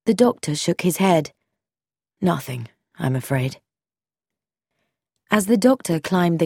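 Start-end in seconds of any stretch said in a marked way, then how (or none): 2.2-3.6 low, soft regretful reply. A narrator's reading. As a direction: gently say there is no hope. Doctor gives no hope.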